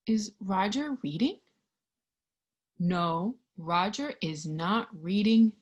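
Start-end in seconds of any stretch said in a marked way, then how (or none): none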